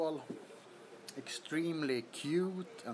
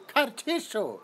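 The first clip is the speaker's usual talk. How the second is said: laughing sneeze word; 6 semitones higher than usual. Retold or imitated. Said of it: "Is that a British thing?".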